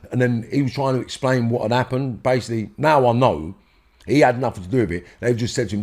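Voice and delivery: normal talking voice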